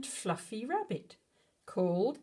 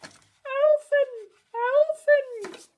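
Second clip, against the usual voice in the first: high, loud distant call; 8 dB louder, much slower than usual. Acted. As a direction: shout the name across the woods. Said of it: "He could hear them shouting".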